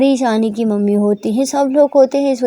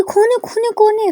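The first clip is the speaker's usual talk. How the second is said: high, startled who's-there; jolted from sleep, calling out; girl woken by banging, startled; startled awake; 11 semitones higher, 3 dB louder than usual. A narrator's reading. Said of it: "वो डर के उठती है".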